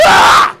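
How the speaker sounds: very loud, harsh sudden scream; mock outburst yell. Someone blows up over one small remark, screaming. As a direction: explode out of nowhere.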